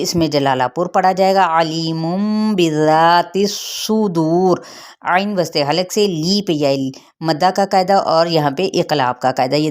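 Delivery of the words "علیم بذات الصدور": long, drawn-out tajweed chant; slow Quran recitation. Shown as a demonstration. Teacher reciting the verse for her students. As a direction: hold each vowel as in recitation. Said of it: "teacher recites Quran verse".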